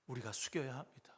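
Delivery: hushed whispered urging. Acted. Pastor calling the flock to bow down, whispered. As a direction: drop to a whisper for weight. Hushed line within the sermon.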